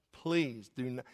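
usual speaking voice

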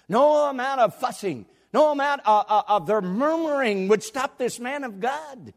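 high, defiant pulpit cry; full-force preaching. Real, not acted. Preacher praising an unshaken man of God, fervent proclamation. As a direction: draw out each "no" and drive it home.

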